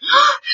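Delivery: sharp, high gasp; horrified gasp. A narrator's reading. Queen reading the dreadful article, horrified gasp. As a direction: suck in breath in shock. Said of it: "punctuated by gasps".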